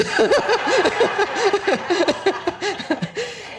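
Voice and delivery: high delighted burst of laughter. Real, not acted. Laugh after quote.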